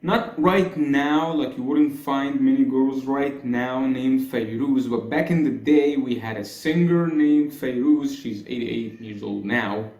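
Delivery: ordinary delivery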